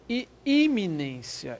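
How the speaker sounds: iminência drawn out, each syllable slow; slow stress on iminência. Shown as a demonstration. Teacher stretching iminência as the key word. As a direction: linger on iminência to mark it.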